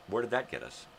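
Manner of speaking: ordinary delivery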